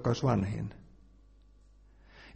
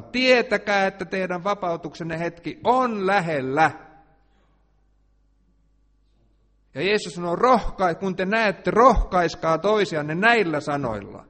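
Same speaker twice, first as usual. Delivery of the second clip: higher, louder sermon delivery; fiery preaching; 4 semitones higher, 6 dB louder than usual. Acted. Preacher urging believers not to fear, fiery. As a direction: rouse the congregation with prophecy.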